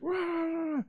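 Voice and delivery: high, soft mock shouting; mock yelling gibberish. Mocking old neighbors yelling on porch, high gibberish. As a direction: wave off a fight as noise.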